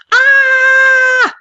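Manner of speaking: high piercing scream; kid's scream. Retold or imitated. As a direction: shriek like a scared kid. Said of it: "follows it up with".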